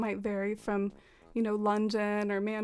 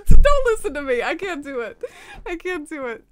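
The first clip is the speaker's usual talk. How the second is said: flustered, loud and higher, laughing through it; embarrassed laughing; 6 semitones higher, 15 dB louder than usual. Real, not acted. Streamer after failing a British accent, embarrassed laughing. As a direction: burst out laughing in real embarrassment. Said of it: "I'm embarrassed now".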